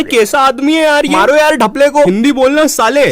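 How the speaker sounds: exasperated heckling. Acted. Meeting members attacking alien who speaks no Hindi, exasperated shouts.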